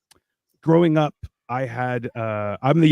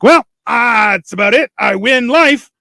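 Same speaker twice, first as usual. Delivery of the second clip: higher, louder victorious cry; triumphant boast; 7 semitones higher, 5 dB louder than usual. Retold or imitated. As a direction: crow about winning.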